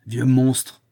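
loud insult; forceful, indignant exclamation; outraged cry. A narrator's reading. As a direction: hurl it at the old man. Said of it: "cria Adon".

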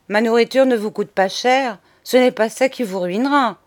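plain reading voice

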